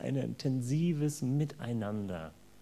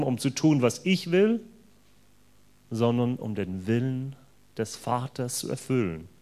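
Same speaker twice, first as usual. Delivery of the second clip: scripture reading; 4 semitones lower than usual. Acted. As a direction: proclaim the words of Jesus to the congregation. Reads Jesus' words from scripture. (feierliche Bibellesung)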